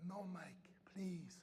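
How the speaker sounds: soft, unvoiced pleading; whispered plea. Girl quietly begging her coach to wait, whispered. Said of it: "girl pleads".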